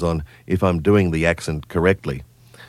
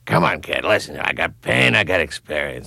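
Burgess Meredith voice. Acted. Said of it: "Burgess Meredith where he said".

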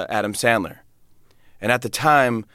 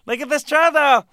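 Pee Wee Herman. Acted. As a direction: squeal it in a silly put-on voice with a goofy laugh. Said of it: "I was doing Pee Wee Herman".

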